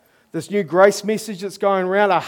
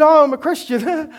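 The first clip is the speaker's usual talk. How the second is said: awkward repeat; 8 semitones higher, 4 dB louder than usual. Retold or imitated.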